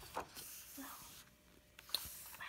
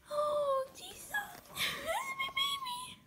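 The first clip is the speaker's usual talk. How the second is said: overwhelmed squeal. Real, not acted. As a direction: squeal over your adored idol. Overwhelmed seeing favorite member's photo.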